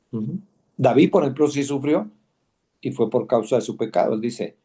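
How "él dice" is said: usual speaking voice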